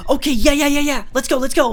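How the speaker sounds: high, loud hyped-up yes-man; mock eager. Vlogger mocks gullible people, high and loud excitement. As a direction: overeager yeah yeah let's go. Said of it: "so quick to just be like".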